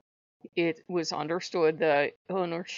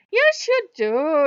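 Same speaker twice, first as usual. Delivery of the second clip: slow, loud, high mock-Irish retort; teasing Irish accent; 8 semitones higher, 10 dB louder, much slower than usual. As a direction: answer back in a drawn-out Irish brogue. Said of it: "Lou is the only one that puts on the Irish accent".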